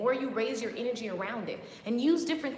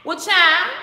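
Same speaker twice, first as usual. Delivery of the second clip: sassy retort; 6 semitones higher, 13 dB louder than usual. Acted.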